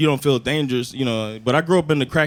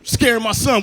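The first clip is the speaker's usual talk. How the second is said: higher, accusing outburst; hammed-up protective anger; mock angry dad; 6 semitones higher than usual. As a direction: act out an indignant father scolding. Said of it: "I got mad".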